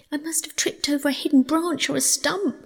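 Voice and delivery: high, plaintive mole voice; miserable mole. A narrator's reading. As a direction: explain it miserably, feeling sorry for yourself. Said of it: "said the mole miserably".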